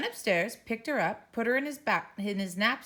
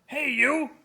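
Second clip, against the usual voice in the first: put-on loud, higher bark at the baby; yelled; 7 semitones higher, 8 dB louder, much slower than usual. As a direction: yell to shut the baby up. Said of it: "He picked up the baby and yelled".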